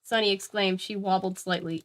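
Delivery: ordinary delivery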